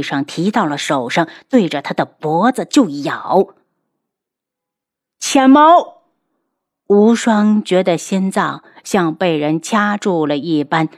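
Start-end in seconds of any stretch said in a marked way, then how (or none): 5.2-6.0 high, loud scream of the name; horrified cry. A narrator's reading. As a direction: cry out in alarm for a loved one. Horrified cry as loved one is bitten.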